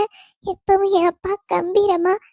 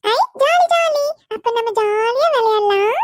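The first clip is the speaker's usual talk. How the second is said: put-on very high, delighted child squeal; a small girl thrilled to play; excited little child; 6 semitones higher than usual. Child cheers about playing. (மிக உயர்ந்த குரலில் குழந்தையின் குதூகலம்)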